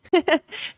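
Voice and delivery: short high-pitched laugh; amused little burst of laughter; chuckling. Real, not acted. Laughs at the username.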